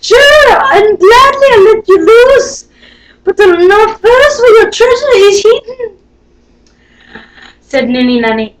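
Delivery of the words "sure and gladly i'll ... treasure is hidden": high, loud little leprechaun; high pleading leprechaun. Acted. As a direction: squeak it out as the wee man. Leprechaun bargaining, voiced high.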